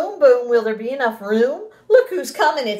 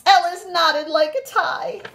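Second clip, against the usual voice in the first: lively, sing-song storybook voice, well above her normal pitch; animated read-aloud to a class; reading to children; 7 semitones higher, slower than usual. Acted. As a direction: read it big and bouncy for little kids. Teacher reads picture book aloud.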